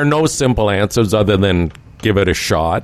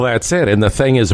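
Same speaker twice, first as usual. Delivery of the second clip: ordinary delivery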